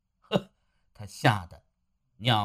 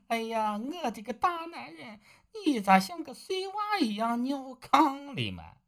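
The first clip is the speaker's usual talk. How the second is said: higher, softer voice, laughing at her husband; teasing wife; 10 semitones higher, 6 dB quieter than usual. Acted. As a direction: she mocks him like a wet-the-bed child. (妻子的声音，高而轻，带着取笑)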